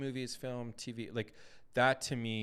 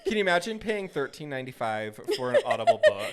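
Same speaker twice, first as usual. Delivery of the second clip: higher, louder, through real laughter; laughing; 5 semitones higher, 8 dB louder than usual. Real, not acted. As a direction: exclaim while cracking up. Cracking up at the bad voice.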